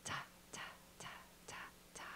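soft unvoiced ta ta in a steady beat; rocking whispered ta's; whispered rhythm. Shown as a demonstration. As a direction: whisper the ta's like patting a baby. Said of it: "put those together in our voices".

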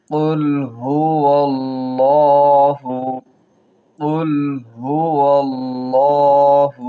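الله with the la held one alif long; lengthened lam. Shown as a demonstration. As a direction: stretch the la of الله one beat. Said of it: "খাড়া জবর আছে, এক আলিফ টান হবে".

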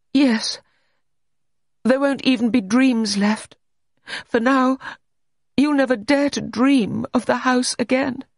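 high, forceful pronouncement; high, haunted insistence; intense warning. A narrator's reading. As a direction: warn him off, pitched high and loud. Allegra warns him off the dream.

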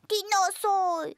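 ordinary delivery

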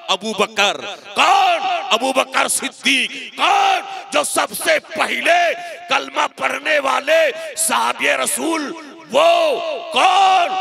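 high, loud, drawn-out shouting oratory; rousing shouted sermon; preaching at full force. Acted. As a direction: call out each phrase to a crowd and hold the endings.

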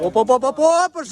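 building cheer up to a yell; excited shout. Real, not acted. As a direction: shout him off the line. Cheering the start.

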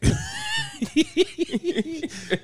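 long, high wheezing cackle; high-pitched laugh. Real, not acted. Host cracking up at his own ear-splitting joke, high laugh. Laughing after a joking remark.

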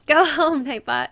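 loud, playful send-off; big drawn-out mock order; mock shout. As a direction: holler it at the bot in mock dismissal. Mock-sending the bot away.

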